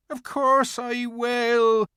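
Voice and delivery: high, slow, earnest faun; a gentle faun giving in. A narrator's reading. Faun promises Lucy to take her home, high and slow. Faun's character voice.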